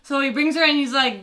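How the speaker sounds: ordinary delivery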